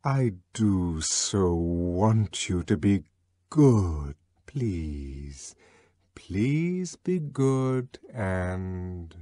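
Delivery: soft, low, very slow pleading; yearning plea. A narrator's reading. Synthetic voice softly begging the crowd to be good. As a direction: beg them gently to be good. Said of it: "with a yearning earnestness".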